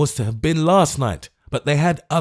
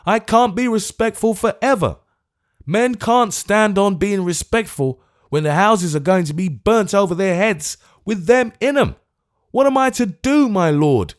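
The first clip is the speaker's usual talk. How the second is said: high, loud indignant tirade; bursting with choleric outrage; old gentleman ranting at the Lord Mayor; furious old man; 10 semitones higher, 4 dB louder than usual. Acted. Old man's furious outburst.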